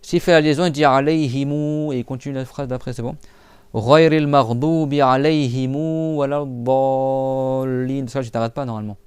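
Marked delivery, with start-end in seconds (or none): 3.7-8.1 slow, drawn-out chanted recitation; chanting the end of the Fatiha slowly; Quran recitation. Acted. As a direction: recite it slowly, holding the long vowels. Recites the verse.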